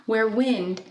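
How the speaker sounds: gently expressive poem recital; poem reading. Acted. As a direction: read the poem to the class, savouring each line. Teacher reads poem aloud.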